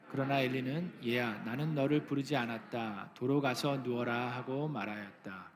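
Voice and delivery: measured, solemn Bible reading; a calm church scripture reading. Acted.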